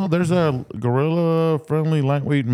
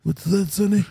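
put-on higher, hard of hearing old man; grumpy old man; 4 semitones higher than usual. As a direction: ask it like a deaf grandpa. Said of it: "old man voice".

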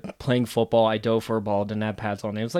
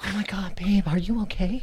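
higher, whispered doting partner; teasing the friend's concern; mock doting whisper; 6 semitones higher than usual. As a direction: coo it like a fussy lover.

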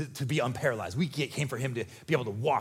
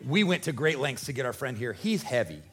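usual speaking voice

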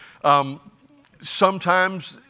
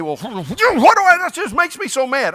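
high, loud, laughing whiny complaint; mock frustrated churchgoer; 11 semitones higher, 7 dB louder than usual. Preacher mocks a congregant's complaint, high laughing whine. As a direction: lampoon a fed-up believer grumbling. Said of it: "and you say".

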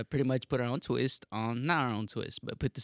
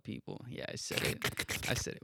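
ASMR whisper; 6 semitones lower, 9 dB quieter than usual. Acted. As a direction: whisper close to the mic. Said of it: "ASMR shit going on there".

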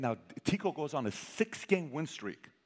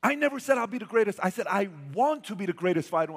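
put-on high, loud kid voice protesting; whiny protest; 7 semitones higher, 5 dB louder than usual. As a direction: mimic the kid's defensive whine. Son quoted protesting.

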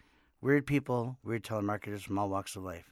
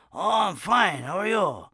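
loud, high-pitched Japanese accent impression; hammed-up Japanese accent, shouted and high; mock Japanese accent; 8 semitones higher, 8 dB louder than usual. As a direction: answer as an exuberant Japanese man. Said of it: "He was Japanese".

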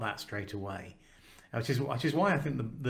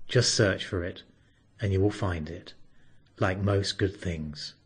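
God voice; 3 semitones lower, 6 dB louder than usual. Acted. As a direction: deliver it like a deep divine voice. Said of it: "Sounds like God".

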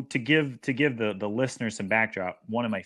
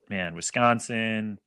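usual speaking voice

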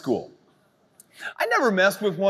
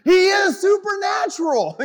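higher, louder declaration ringing out; preaching shout; 12 semitones higher, 6 dB louder than usual. Real, not acted. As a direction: proclaim it with full force. Preacher declaring over skeptics.

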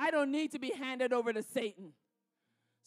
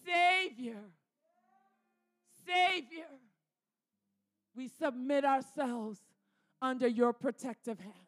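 closing prayer; 4 dB louder than usual. Acted. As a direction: pray it over them, ending hushed. Closing prayer over Isaiah 43.

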